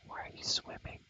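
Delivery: ordinary talk, nothing marked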